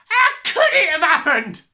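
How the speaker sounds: high, very loud yell. A narrator's reading. Father demanding answers from the family, yelling. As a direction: yell it in disbelief. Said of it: "he yelled".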